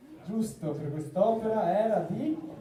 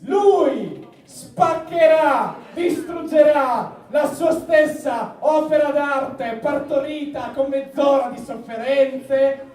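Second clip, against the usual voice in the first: slow, loud showman proclamation; grand announcement; 4 semitones higher, 7 dB louder, slower than usual. Acted. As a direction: announce it like a circus ringmaster. Pompous announcement of destruction. (annuncio solenne da imbonitore)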